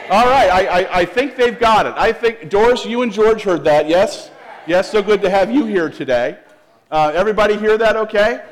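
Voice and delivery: big, high outdoor voice. Real, not acted. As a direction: call out loudly over the congregation. Answering congregation's outside-voice shout.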